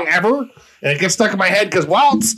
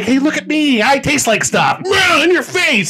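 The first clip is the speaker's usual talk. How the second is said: lightly mocked show-off, raised pitch; higher, punchy mock bragging; brash boasting beer; 6 semitones higher than usual. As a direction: voice a pushy, in-your-face beer.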